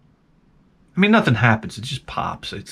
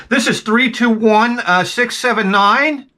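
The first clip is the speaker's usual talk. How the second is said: slow, slightly higher, self-important radio voice; mock dispatcher voice; 7 semitones higher, slower than usual. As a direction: play a kid pretending to dispatch on the radio. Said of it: "I'm gonna talk like this".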